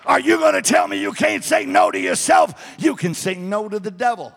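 loud, high preaching with fire. Acted. Pastor urging the church to control their inner voices, preaching loud. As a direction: preach it to the congregation with full force.

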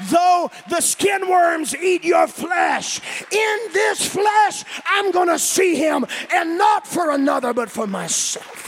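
slow, rising to a loud high shout; building preached shout. Acted. Preacher quoting Job to the congregation, building to a shout. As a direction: start slow and build to full-force preaching.

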